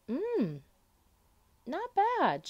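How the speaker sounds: high, bright rising approval; pleasantly surprised. Acted. Lola tastes a carrot, grudgingly pleased. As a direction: savour it, then admit it.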